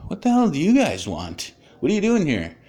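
high-pitched, indignant demand; retold aggressive challenge. Man opening his door to unwelcome evening visitors, aggressive challenge. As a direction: confront unwanted callers, re-enacting the annoyance. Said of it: "I was really aggressive".

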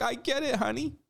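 sarcastic impression of online supporters, pitched high; mock sympathetic supporter. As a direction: coo it like a sarcastic fan patting her head. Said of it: "A lot of these people were like".

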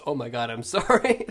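a hint of a sheepish moviegoer, laughing; laughing mock apology. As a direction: play the embarrassed guy while cracking up. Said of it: "the guy goes".

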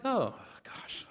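whispered mock dismay; sheepish, breath-only groan of words; dreading aside. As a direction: whisper it as if caught out. Retells dreading friend's question.

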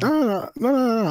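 squealed repeated no; playful high-pitched denial; high, excited protest. Real, not acted. Insisting playfully.

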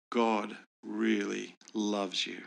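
very slow, spacing out each word; slow, earnest assurance. Retold or imitated. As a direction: let every word land slowly. Earnest message to young woman.

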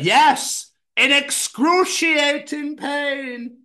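high, very slow mystic wail; spooky fortune teller. Retold or imitated. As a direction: draw out the doom eerily. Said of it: "Fortune teller".

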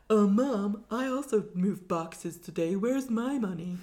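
slow put-on voice of a needling son; Stephen. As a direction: drawl it at Mum in a mock character voice. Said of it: "And he's like".

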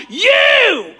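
full-throated high shout; preacher leading a call-and-response shout. Real, not acted. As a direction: belt the word You as loud as you can. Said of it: "say that with me with all you got".

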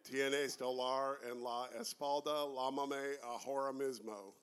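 Spanish in a hammed-up American accent. Shown as a demonstration. Co-host clowning for the other hosts, mock American accent. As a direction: read the Spanish like an American, drawn out at the end. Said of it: "do my American accent".

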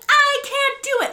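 mock panicked wail, very high and loud; hammed-up hysterical yell; freaking out. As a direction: imitate a woman losing it, drawn out. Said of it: "like, freaking out".

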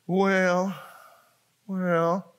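drawn-out, evasive stalling; hedging. Acted. Newcomer dodging the angel's forgiveness question, mock hedging. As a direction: stall awkwardly, stretching the word out.

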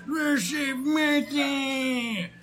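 slow, hammed-up shout; mock Superman shout. Critic mocks Superman's dying plea with a slow, hammed-up shout. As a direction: drag the plea out as a parody. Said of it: "Superman does this".

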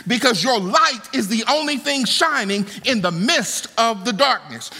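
ordinary talk, nothing marked

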